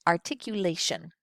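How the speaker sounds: articulation with every consonant and vowel hit crisply; 一音一音はっきりと. Shown as a demonstration. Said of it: "you hear me hitting all of those consonants and vowels".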